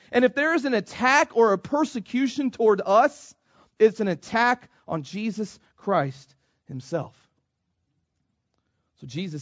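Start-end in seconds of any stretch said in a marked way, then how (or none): none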